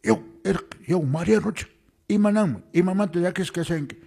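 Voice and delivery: put-on a caretaker hailing a sad child; janitor calling to boy. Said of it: "Chays nin".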